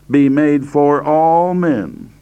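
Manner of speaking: "all" drawn out long and strong; stress on all. Shown as a demonstration. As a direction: lean slowly into "all". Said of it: "I'm emphasizing the word all here".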